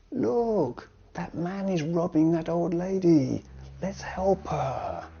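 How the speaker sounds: high-pitched cry of alarm; acted alarm at spotting a robbery; alarmed call. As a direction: call out urgently for help, drawing out the end. Acted example of witnessing a robbery.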